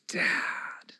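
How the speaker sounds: put-on breathy whisper of the kids' call; whispered memory of a call. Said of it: "my kids would yell".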